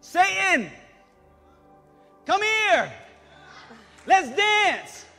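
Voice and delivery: usual speaking voice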